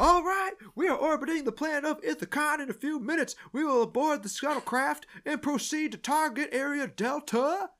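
high-pitched drill sergeant briefing; screaming robotic sergeant. Retold or imitated. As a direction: talk like a robot stuck screaming orders. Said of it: "It's like he's screaming".

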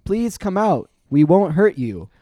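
high, projected plea; loud, clear voice. A narrator's reading. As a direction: call clearly to someone hidden inside. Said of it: "Tobias tried again".

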